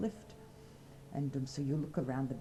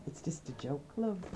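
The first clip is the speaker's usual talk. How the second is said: ordinary talk, nothing marked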